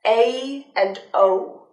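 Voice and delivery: "a" and "o" slowly glided into two sounds; diphthong a and o. Shown as a demonstration. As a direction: stretch "a" and "o" into glides. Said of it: "don't change it to the diphthongs".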